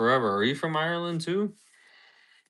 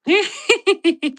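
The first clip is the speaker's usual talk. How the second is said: very high, loud ghoulish cackle; Crypt Keeper cackle; 8 dB louder than usual. Acted. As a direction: shriek out a rapid, piercing horror-host laugh. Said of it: "that's how we laughed".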